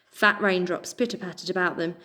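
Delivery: ordinary delivery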